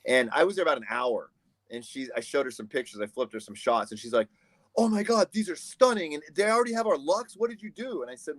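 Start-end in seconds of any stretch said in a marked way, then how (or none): 4.8-8.0 high-pitched imitation of an astonished fan; retold wowed reaction; amazed praise. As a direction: gush in mock amazement, well above your own voice. Retelling impressed D P's reaction.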